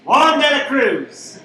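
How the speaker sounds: "Juan de la Cruz" cried out loud and slow, drawn out; mock exaltation. Name shouted in mock ecstasy.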